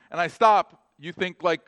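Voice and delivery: ordinary talk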